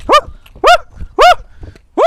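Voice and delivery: very high, loud whooping yelps; panicked whoops. Real, not acted. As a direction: whoop in panic while running off. Fleeing the swarm.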